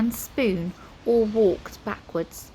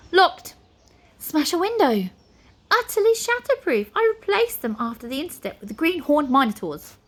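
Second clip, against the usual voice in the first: curt high wizard; 7 semitones higher, 4 dB louder than usual. A narrator's reading. Flabbergast curt replies.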